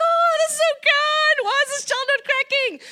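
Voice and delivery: high, disbelieving, slowed exclamation; amazed. Retold or imitated. Staff marvelling at a calm child, mock disbelief. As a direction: gasp out amazement.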